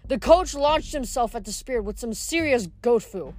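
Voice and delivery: plain reading voice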